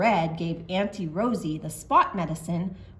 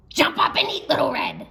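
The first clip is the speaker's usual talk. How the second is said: higher, louder, gleeful; gleeful pounce; 7 semitones higher, 6 dB louder, faster than usual. Acted. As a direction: burst out with greedy glee. Lion relishing the pounce.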